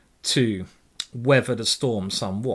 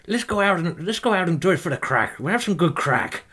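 quick, raised mock-Irish urging; Irish accent; 5 semitones higher than usual. Irish person proposing a night out, imitated Irish accent. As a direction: put on an eager Irish accent. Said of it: "this person I was with was".

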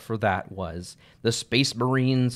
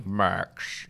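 low, far-off villain voice; a hint of a creepy villain; Vecna voice; 6 semitones lower, 5 dB quieter than usual. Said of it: "I remember him saying".

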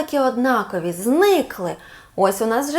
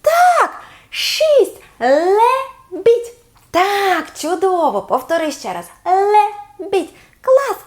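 excited cheer; 7 semitones higher, much slower than usual. Acted. Teacher cheers the child's correct guess, high and delighted. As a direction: celebrate the swan match. Match found in memory game.

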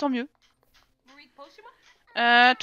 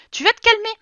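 higher, louder cross-parent scold; higher and louder playful telling-off; mock parent scolding; 5 semitones higher, 4 dB louder than usual. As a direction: tell off the naughty kids. Scolding fighting Sims kids.

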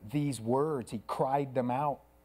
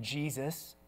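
ordinary delivery

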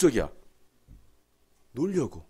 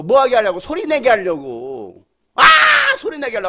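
ordinary talk

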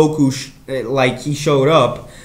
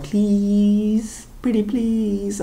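higher, softer wheedling please; pleading; 7 semitones higher, 4 dB quieter, much slower than usual. Real, not acted. Fan begging for Super Saiyan three Vegeta, higher and softer pleading.

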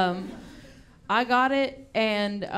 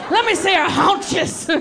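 much higher, louder hearty doctor voice; hearty shouting doctor; 7 semitones higher, 5 dB louder than usual. Retold or imitated. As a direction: boom it out like a jolly country doctor. Doctor examining legs.

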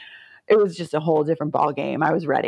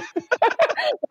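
much higher burst of laughter. Real, not acted. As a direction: let out a high real laugh. Laughing at her own story.

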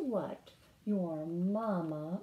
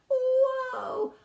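amazed whoa; 8 dB louder than usual. Acted. Toad amazed again.

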